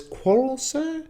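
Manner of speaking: exaggerated courtesy with a big pitch swing; mock politeness. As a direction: feign polite surprise, then drop the pitch to deny it. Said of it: "this kind of mock politeness again".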